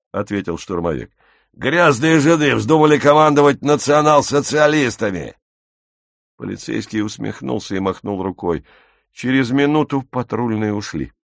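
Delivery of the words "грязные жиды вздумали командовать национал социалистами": louder, higher stormtrooper outburst; raised voice full of scorn; contemptuous outburst. Acted. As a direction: spit out the insult loudly. Stormtrooper's abusive reply to the police.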